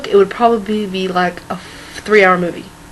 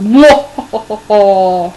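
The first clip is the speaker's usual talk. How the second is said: loud scheming laugh; 2 semitones lower, 6 dB louder than usual. Acted. Villainous laugh.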